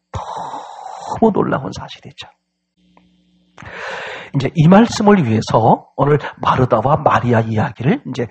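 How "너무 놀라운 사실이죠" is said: slow, whispery amazement; hushed awe. Real, not acted. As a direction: draw out the first word almost voicelessly.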